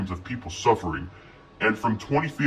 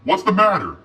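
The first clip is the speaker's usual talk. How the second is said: put-on higher, urgent girl's question; 10 semitones higher, 6 dB louder than usual. A narrator's reading. Lizzie asking what happened, higher urgent voice. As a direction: ask it in a raised young voice.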